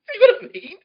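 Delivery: high, loud squeal through laughter; helpless real laughter through the words; laughing hard. Real, not acted. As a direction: crack up while making the point, voice shooting up. Speaker cracking up at own joke.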